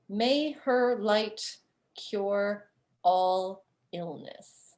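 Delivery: slow, solemn reading of the words; reading inscription. Acted. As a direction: intone it like a carved motto, slowly.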